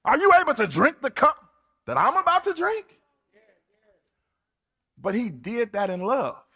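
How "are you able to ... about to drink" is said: rising to a higher, louder challenge; Jesus questioning his disciples, built up loud; Jesus answering. Retold or imitated. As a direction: press them with the question. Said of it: "He was like".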